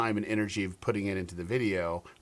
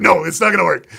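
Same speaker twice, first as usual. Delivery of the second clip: higher and much louder, a firm no; loud flat refusal; 8 semitones higher, 14 dB louder than usual. Retold or imitated. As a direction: shut the idea down loudly. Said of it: "he's like".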